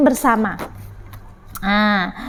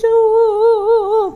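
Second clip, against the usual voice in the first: high, long wavering boo-hoo; pretend crying; 7 semitones higher than usual. Ciki goes home in tears, pretend wail. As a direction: wail high like a storybook child.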